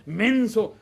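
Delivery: lightly acted scornful name-calling; ugly insult. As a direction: imitate a parent snapping at a child. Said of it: "esas palabras son muy feas".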